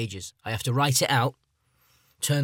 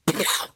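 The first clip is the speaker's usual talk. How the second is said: explosive mouth-made blast; imitated gunshot; 7 semitones higher than usual. Mates playing multiplayer games, imitated shooting sound. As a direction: make a gun noise with the mouth.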